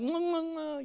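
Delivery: pretend chewing smacks. Pretending to eat it.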